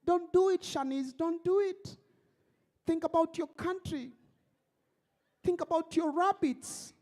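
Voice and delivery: mother begging her crying daughter not to do it; mock pleading mother. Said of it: "pleading with her".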